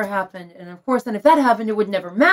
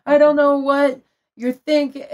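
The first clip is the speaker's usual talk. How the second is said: higher, teary wail reliving it in the bathroom; retold crying; 6 semitones higher, 4 dB louder than usual. Locked in the spare bathroom, crying to herself, teary. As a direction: replay the tearful moment, voice breaking a little. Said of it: "then I went".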